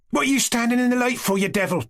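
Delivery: sharp high scolding cry; girl scolding a servant blocking the light, shouting; shouted. A narrator's reading. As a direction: snap at him, pitched high. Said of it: "the girl suddenly shouted".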